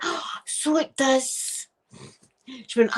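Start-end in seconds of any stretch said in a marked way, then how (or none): 0.0-1.7 high, slow, drawn-out mock realisation; dawning realisation. Mum realising her wrong word, dawning horror. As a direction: let the mistake dawn slowly, acting it out.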